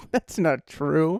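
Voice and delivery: drawn-out, deliberate that's not true; slowed, measured protest; slow, level denial. Real, not acted. Son denying being a gaslighter.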